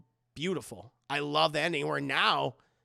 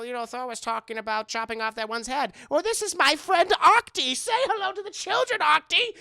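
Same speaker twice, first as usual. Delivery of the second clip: creepy puppet show; 10 semitones higher, 5 dB louder than usual. Retold or imitated. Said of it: "dark version of a puppet show".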